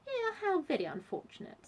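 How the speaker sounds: high-pitched polite sympathy; genteel Vanessa. A narrator's reading.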